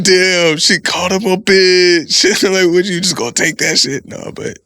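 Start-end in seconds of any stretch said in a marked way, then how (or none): 0.0-2.2 higher and louder, amused by it all; impressed disbelief. Retold or imitated. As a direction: sound like you're secretly impressed. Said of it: "a part of me would be like".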